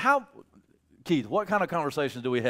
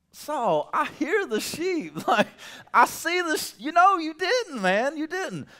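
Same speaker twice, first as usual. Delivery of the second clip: high, louder mock Samuel, incredulous; voicing Samuel; 11 semitones higher, 4 dB louder than usual. Samuel catches Saul lying, incredulous and loud. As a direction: call him out, half laughing.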